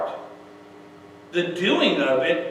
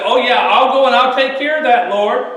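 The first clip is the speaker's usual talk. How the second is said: loud, higher over-willing promise; mock eagerness; 5 semitones higher, 8 dB louder than usual. Moses readily agreeing with the Lord, mock eager. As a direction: jump at the task, hammed up.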